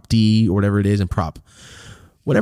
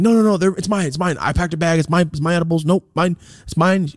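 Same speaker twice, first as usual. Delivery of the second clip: much higher, insistent; high earnest mock claim; 8 semitones higher than usual. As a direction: friend owning up to the cop. Friend takes blame.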